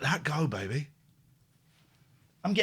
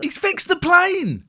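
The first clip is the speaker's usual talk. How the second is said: incredulous; 13 semitones higher, 6 dB louder, slower than usual. Real, not acted. Incredulous at the film plot.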